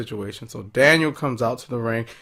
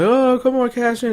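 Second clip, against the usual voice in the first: high, loud eager urging; mock urging; 12 semitones higher, 5 dB louder than usual. Reviewer voicing Ellsworth begging her to cash in, high and loud.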